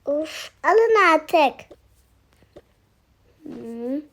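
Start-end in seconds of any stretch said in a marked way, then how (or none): none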